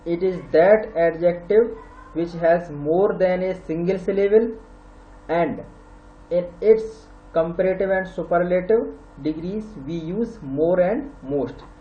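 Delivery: very slow, word by word, for students to copy; dictation pace. Shown as a demonstration. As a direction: spell it out slowly like dictation. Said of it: "teacher dictating the English definition".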